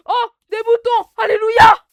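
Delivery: high, loud joyful cry; excited shout. Real, not acted. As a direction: burst out in delight. Excited at finding sheep.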